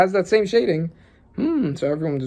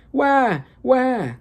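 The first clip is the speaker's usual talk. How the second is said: high mock baby wail; imitated baby cry; 8 semitones higher than usual. Reader voicing the newborn twins, high mock wail. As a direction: squall like a newborn.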